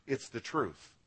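ordinary talk

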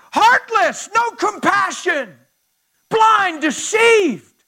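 loud, soaring preacher's cry; full-throated pulpit preaching; preaching at full force. Real, not acted. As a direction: preach it out to the whole room, building.

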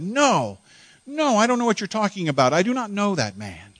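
high, frantic cursing denial; panicked denial. Acted. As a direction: deny it in a rising panic. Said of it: "I just started cursing".

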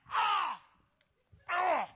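high, loud mock yelp; startled cry. Man hit by the pastor's hand, yelping. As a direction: cry out as if smacked. Acts being smacked by the hand.

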